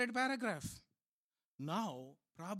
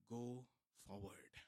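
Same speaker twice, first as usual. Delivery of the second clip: much softer, almost breathed; hushed command; 10 semitones lower, 14 dB quieter, slower than usual. Shown as a demonstration. Preacher quoting God to Moses, hushed and intimate. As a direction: lean in and drop the voice low and soft.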